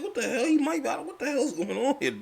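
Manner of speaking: high-pitched stunned disbelief; incredulous. Retold or imitated. Fan staring at Spurs lineup, high incredulous outcry. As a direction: voice jumps up, baffled what the hell. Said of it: "I was like".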